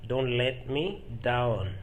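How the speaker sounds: "Don't let me down" stretched out; slow, careful teaching take; slow repetition. Shown as a demonstration. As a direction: give each word of "Don't let me down" room.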